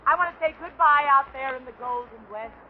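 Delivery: ordinary delivery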